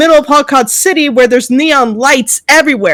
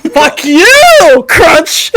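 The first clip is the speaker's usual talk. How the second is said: loud mock shout; 6 semitones higher, 4 dB louder, much slower than usual. Repeats the joke line.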